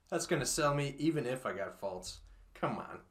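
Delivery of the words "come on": ordinary delivery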